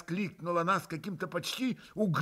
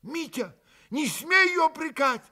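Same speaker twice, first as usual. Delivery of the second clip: high, rising angry shout at his brother; heated shout; 13 semitones higher, 5 dB louder than usual. A narrator's reading. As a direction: shout him down hotly. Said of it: "горячо крикнул на брата Алёша". (горячий окрик на брата)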